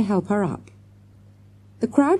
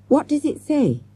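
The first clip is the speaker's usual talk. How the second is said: urgent question pitched much higher; 10 semitones higher than usual. A narrator's reading. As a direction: press for the answer, eager and quick. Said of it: "Morgan asked urgently".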